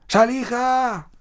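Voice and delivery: long high drawn-out call; summoning a spirit from the river bank. Acted. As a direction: call the name slowly across the water.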